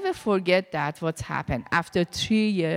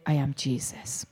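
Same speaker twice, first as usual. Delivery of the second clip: Jesus answering; 7 semitones lower, 6 dB quieter than usual. Retold or imitated.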